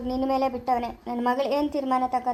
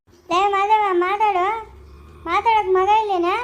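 loud, higher demand for an answer; angry yell; 4 semitones higher, 5 dB louder, slower than usual. Acted. Elder yelling at Madhava to answer, loud. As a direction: bark at him to speak up now.

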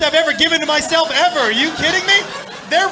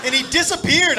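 ordinary talk

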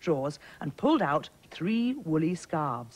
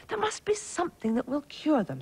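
storybook prince; 10 semitones higher than usual. A narrator's reading. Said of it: "said the Prince".

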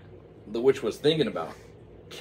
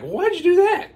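very high, loud puzzled falsetto; high incredulous witch voice; 11 dB louder than usual. Acted. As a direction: squawk it baffled like the witch. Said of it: "the witch was like".

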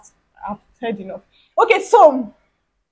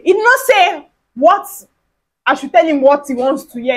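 ordinary delivery, nothing marked

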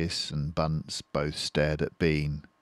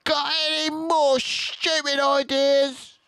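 much higher, louder, slow jeer; dwarf shouting to deaf Bean; shouting Bunce; 5 dB louder than usual. A narrator's reading. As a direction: yell the taunt at a deaf man. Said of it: "Bunce shouted back".

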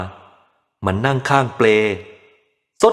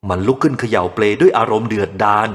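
ordinary delivery

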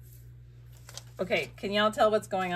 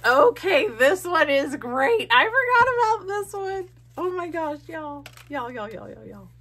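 high, loud and gushing; rediscovering a favourite page with delight; excited squeal; 10 semitones higher, 7 dB louder than usual. Real, not acted. Crafter finding a forgotten collage page, squealing excitedly.

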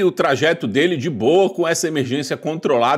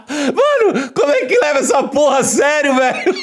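laughing; 7 semitones higher than usual. Real, not acted. Cracking up at pilots.

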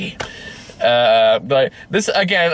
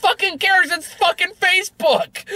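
much higher, incredulous rant; exasperated outburst. Real, not acted. Ranting to cohost about the film, high exasperated outburst. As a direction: throw your hands up, voice flying high.

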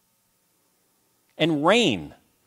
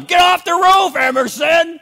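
much higher, louder alarmed shout; yelling at grandson; 11 semitones higher, 6 dB louder than usual. Retold or imitated. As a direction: yell it up at a kid on the roof. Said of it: "I'd still yell at him".

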